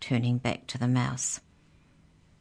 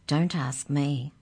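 ordinary delivery, nothing marked

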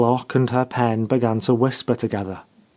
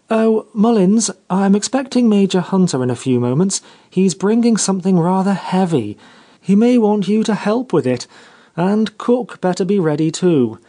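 raised, airy voice of command; lofty mistress; 6 semitones higher than usual. A narrator's reading. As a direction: give the servant orders from on high. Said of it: "Geraldine's manner was slightly lofty".

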